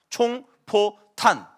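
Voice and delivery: 총포탄 stretched very slowly; slow, emphatic reveal; drawn out. Shown as a demonstration. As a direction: spell out each syllable for effect. Punchline of the triplet names.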